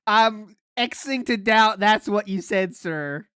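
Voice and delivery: slow, loud disbelief, dropping on "sir"; emphatic doubt. Real, not acted. Host doubting what the storyteller claims he said, slow emphatic skepticism. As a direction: draw out the skepticism, loud and deliberate.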